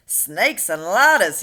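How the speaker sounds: loud, slow yell of alarm; the police head bellows it out. A narrator's reading. Police chief yelling at the monster on the peach. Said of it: "yelled the head of police department".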